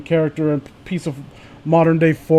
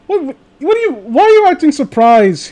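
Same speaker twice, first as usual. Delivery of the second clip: incredulous; 9 semitones higher, 5 dB louder than usual. Real, not acted. Mock disbelief at a friend.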